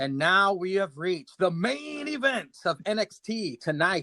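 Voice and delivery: announcer voice reading off notes. Acted. Host reads the main event billing in an announcer voice. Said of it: "He's literally reading his notes".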